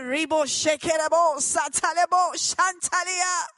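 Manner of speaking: loud, high ecstatic tongues; speaking in tongues. Retold or imitated. Girl in pain with a broken wrist, crying out high and loud in tongues. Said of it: "began to speak in tongues".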